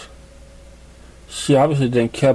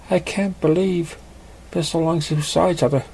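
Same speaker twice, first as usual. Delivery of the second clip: put-on cheerful old friend catching up; gentle female pony voice; young mare; 5 semitones higher than usual. Female character voice.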